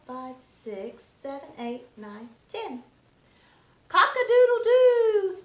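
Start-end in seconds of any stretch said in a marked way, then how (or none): 3.9-5.4 loud high cock-a-doodle-doo; rooster crow. Acted. Teacher crowing as the barn rooster for children, loud and high.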